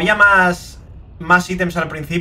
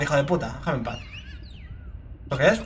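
ordinary talk

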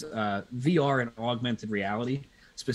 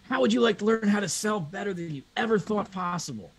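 pushy salesman; 8 semitones higher than usual. Retold or imitated. Pushy sales rep pitching Kyle.

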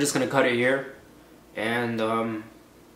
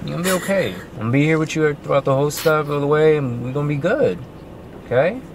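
reassuring; 4 semitones higher than usual. Real, not acted. Husband soothing wife before surgery.

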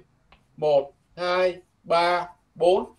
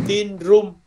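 slow model sentence; much slower than usual. Shown as a demonstration. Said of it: "phòng số ba trăm lẻ sáu".

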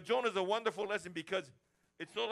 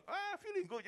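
1 friend's reply. Retold or imitated. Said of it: "They say".

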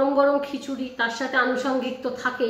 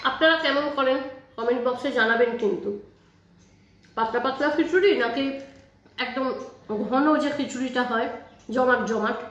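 speaking between bites; talking while chewing. Real, not acted. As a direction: mouth partly full of food. Seen 1: Eats khichuri by spoon at 2.2s, talks with food in mouth.

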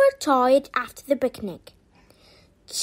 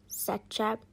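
ordinary delivery

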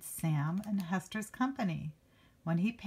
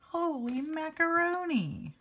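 put-on much higher, gleeful childlike cry; kid's exclamation; 10 semitones higher than usual. Reader voicing the kid seeing new kittens, gleeful. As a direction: shout it like an amazed little kid. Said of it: "That kid says funny things".

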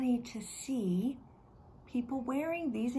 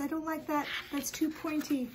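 higher, fussy little man; a pitched-up, fussy voice for the picky man; picky man's voice; 6 semitones higher than usual. Acted. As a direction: turn your nose up at the hat, pitched up. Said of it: "He says".

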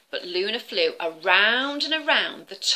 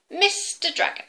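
higher, formal address; stern owl; 11 semitones higher than usual. Acted. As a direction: call him out firmly. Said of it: "said Luna".

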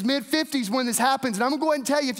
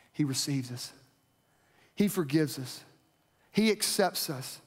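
hushed, much lower and softer; soft, low-voiced comfort after a pause; tender reassurance; 7 semitones lower, 5 dB quieter than usual. Real, not acted. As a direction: drop low and gently reassure. Gentle assurance of God's mercy.

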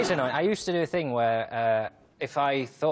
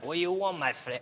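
put-on Spanish accent, opening higher; 4 semitones higher, 3 dB quieter than usual. Shown as a demonstration. Comedian doing his Spanish for the host, Spanish accent. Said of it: "Do the Spanish".

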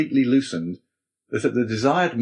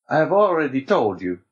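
lightly voiced Abbé, higher pitch; a touch of firm insistence, raised pitch; 5 semitones higher, 4 dB louder than usual. A narrator's reading.

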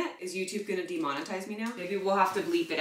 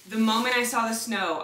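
ordinary talk

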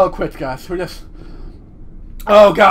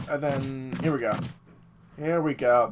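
ordinary delivery

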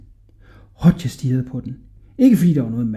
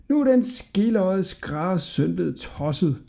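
slow, high gossip's voice; gossiping townsfolk; 5 semitones higher, 5 dB quieter than usual. A narrator's reading. Townsfolk talking about the tailor's son, gossiping. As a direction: drawl it to the neighbours. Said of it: "sagde folkene i Pjort til hinanden".

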